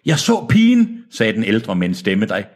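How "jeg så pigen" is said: high, raised-voice character line; high-voiced elder. Old man answering his interrogator, high raised voice. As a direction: pitch it well up, fairly forceful. First take, before whisper narration.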